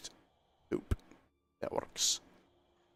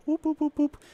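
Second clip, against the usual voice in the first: playful high whoops for each mouse jerk; high whoop sound effect; 14 semitones higher than usual. Acted. Showing viewers the mouse speed, he chirps high whoops.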